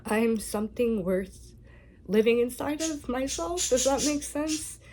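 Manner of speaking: fighting back tears while finishing the thought; emotional, pitch lifting and wavering; choked up. Real, not acted. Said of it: "Sorry for getting emotional".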